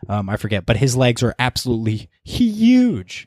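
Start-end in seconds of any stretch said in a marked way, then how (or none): 2.2-3.3 HUGE drawn out, sliding down from high; emphatic. Real, not acted. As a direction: stretch the word huge for emphasis. Emphasising how big his legs are.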